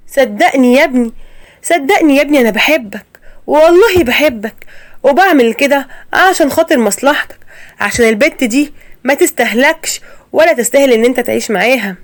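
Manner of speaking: high, pleading voice; mother pleading with her son that she loves him. Acted. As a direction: beg him to believe you.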